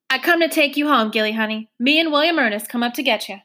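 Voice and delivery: plain reading voice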